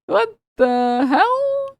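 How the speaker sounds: very slow, high, drawn-out incredulity; stunned disbelief. Real, not acted. Streamer stunned by the fans' behavior, drawn out. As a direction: stretch it out, baffled.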